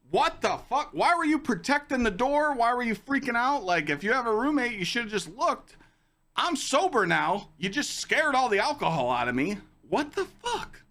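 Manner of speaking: a retold freak-out, pitched high and pushed; mock outraged rant. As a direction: replay the blow-up at her, exasperated and loud. Retelling his outburst at her.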